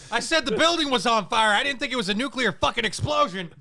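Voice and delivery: high, laughing impression of a stunned ranter; mock his disbelief while cracking up; laughing mock Alex. Mocking Alex's stunned admission.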